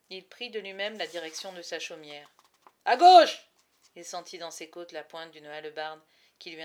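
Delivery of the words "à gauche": very loud, high command; barked order. Acted. Guard shouts at the prisoner to turn left, very loud. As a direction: snap the direction at him.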